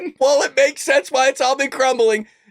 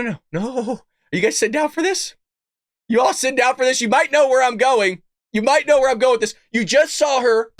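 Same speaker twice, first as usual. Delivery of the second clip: usual speaking voice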